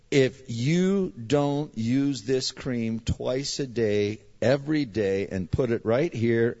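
slow, emphatic doctor's warning; making it clear. Retold or imitated. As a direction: spell out the instruction word by word, very slowly. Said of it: "let me make it real clear".

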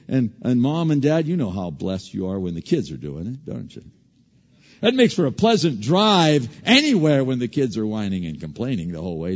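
usual speaking voice

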